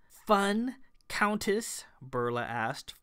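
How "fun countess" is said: high, incredulous and sharp; a scornful, higher echo; fuming. A narrator's reading.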